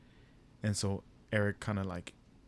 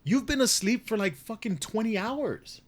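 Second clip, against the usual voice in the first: acted exasperation, pitched way up; exasperated disbelief; 11 semitones higher than usual. Acted scolding of sleepy dad.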